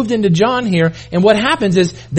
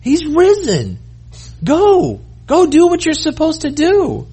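angels at the tomb; 9 semitones higher than usual. Acted. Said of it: "these two men say".

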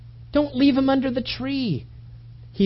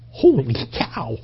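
amazed; 8 semitones lower, slower than usual. Retold or imitated. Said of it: "you went".

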